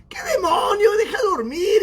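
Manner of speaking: high, whiny mock protest; acted-out high-pitched exasperated cry; annoyed sleeper. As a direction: imitate someone cross at being woken. Acts sleepy cousin's annoyed reply.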